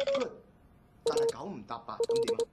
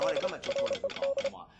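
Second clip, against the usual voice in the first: usual speaking voice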